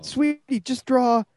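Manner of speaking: put-on high-pitched pleading to a child; imitated parental coaxing. Dad coaxes upset daughter to draw, high pleading.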